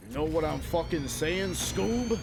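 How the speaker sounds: hammed-up New York mobster demanding agreement. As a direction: push the New York wiseguy act, rising on the name. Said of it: "like a New York mobster".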